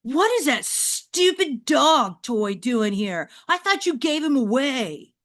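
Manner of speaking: put-on teenage boy shout. Teen boy yelling at Mom about the toy dog, loud. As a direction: bark it like a fed-up teenager.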